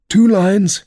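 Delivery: high, excited Mock Turtle call; excited high-voiced interjection; crying out. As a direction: pipe up eagerly, pitched well above normal. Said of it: "cried the Mock Turtle".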